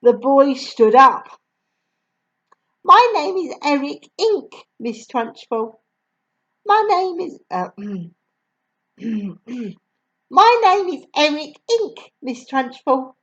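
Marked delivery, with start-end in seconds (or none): 2.8-13.2 put-on higher, timid boy's voice; small boy. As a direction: answer nervously like a child. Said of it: "he said".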